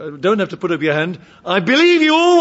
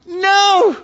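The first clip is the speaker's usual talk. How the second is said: high, loud desperate cry of no; goat's protest; 13 semitones higher, 5 dB louder than usual. Pretend. Goat protests having its insides ripped out, loud high cry. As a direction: cry out in horror at being gutted.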